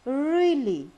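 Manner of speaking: REALLY long and loud, pitch arching high; exaggerated pitch swoop on "Really"; intonation demo. Shown as a demonstration. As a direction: stretch "Really" in a big melodic curve. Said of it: "down and then up".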